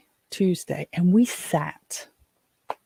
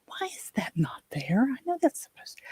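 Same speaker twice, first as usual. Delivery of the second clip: whispering to herself, puzzled; under her breath at the calendar; whispered muttering; 9 semitones higher, 4 dB quieter than usual. Real, not acted.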